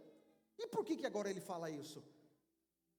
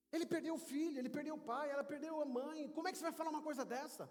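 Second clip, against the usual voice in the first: higher-pitched, mildly outraged protest; indignant bystander; 5 semitones higher, faster than usual. Acted. Bystander scolds visitor at a wake, indignant. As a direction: take someone to task in disbelief.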